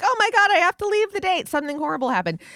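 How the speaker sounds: hammed-up fake panic to escape a date. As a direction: burst out like it's an emergency. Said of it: "And then you're like".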